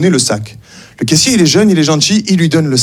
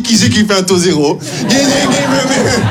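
mock dog-talk aimed at a banker; silly dog-owner voice; 3 semitones higher than usual. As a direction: coo the bank question like to a puppy. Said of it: "je parle pas comme ça à mon banquier".

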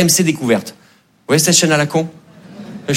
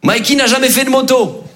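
Mikey's father; 9 semitones higher, slower than usual. Retold or imitated. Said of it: "il fait".

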